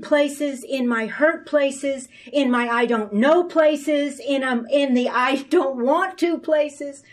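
fervent sermon climax; preaching. Acted. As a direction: pile up each place with growing force. Preaching builds in force.

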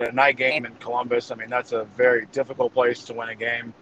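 ordinary delivery

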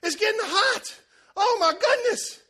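high, loud and amazed, slowing at the end; amazed excitement. Retold or imitated. Woman feeling her knee heal, crying out high and loud. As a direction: marvel out loud as the heat spreads. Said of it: "And she goes".

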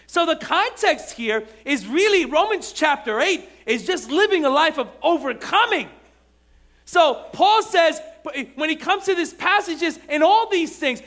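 loud, high, driving sermon climax; full-force preaching. Acted. Pastor pressing the congregation, shouting high and strong.

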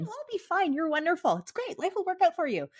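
very high, gushing comforter; hammed-up, high-pitched soothing; mock reassurance. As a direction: imitate someone brightly brushing fears away. Acting someone dismissing her feelings.

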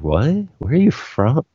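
puzzled. Retold or imitated. People reacting to how the speaker says water, puzzled. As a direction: imitate a baffled listener questioning the accent.

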